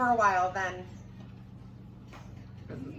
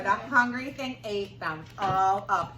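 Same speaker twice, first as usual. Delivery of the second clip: plain reading voice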